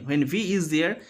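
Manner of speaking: normal talking voice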